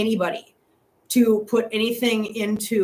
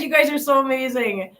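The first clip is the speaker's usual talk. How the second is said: usual speaking voice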